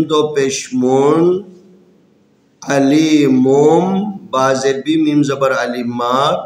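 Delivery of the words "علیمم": علیمم said slowly, ending on a held m; n turned to m. Shown as a demonstration. Teacher drills students on علیمم, slow m ending. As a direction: draw out علیمم and land on the m. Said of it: "نون کی آواز کو میم کی آواز".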